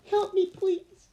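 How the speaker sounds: high, slow, plaintive plea; whimper. Acted. Trapped girl begging those above, high whimper. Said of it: "I whimper".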